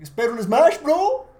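very loud, high-pitched fan yell; excited shout. Real, not acted. Podcaster yelling his hope for a new Smash, excited shout. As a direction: burst out yelling, voice shooting way up.

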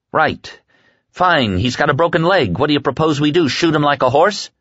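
high, heated challenge; exasperated sarcasm. Acted. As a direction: challenge them with bitter sarcasm. Larry argues bitterly about broken leg.

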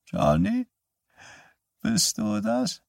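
put-on high, thin, slow old woman's voice; frail old mother. Dying mother calls for her son, high and thin. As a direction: ask feebly, drawn out.

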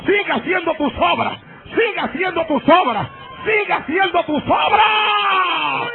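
high full shout, slowing on the last; shouting drunk man. Retold or imitated. Drunk man shouts at the pastor in the plaza, high building yell. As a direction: bellow it three times, building. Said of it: "Y me grita".

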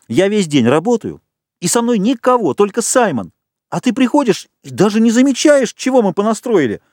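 high-pitched, aggrieved reproach; worked-up, accusing rant; indignant tirade. A narrator's reading. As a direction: pour out your grievance, pitch way up. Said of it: "от негодования уже понесло".

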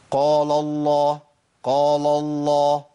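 slow recited قال الله, heavy qaf then thin lam; heavy qaf, light lam. Shown as a demonstration. Teacher models قال الله for students, heavy qaf, light lam. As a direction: hold قال الله long, keeping the first lam light. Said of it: "أنا عندي القاف مفخمة واللام مرققة".

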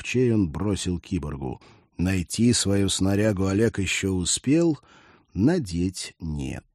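usual speaking voice

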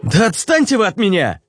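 exasperated outburst. A narrator's reading. Ruslan snaps at the spirits.

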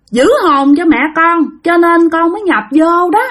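put-on high, whining child's voice, pleading to be believed; whiny little child. Child spirit explaining herself to the old monk, high whiny kid voice. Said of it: "y chang như mấy đứa con nít nhỏ nhỏ nhõng nhẽo".

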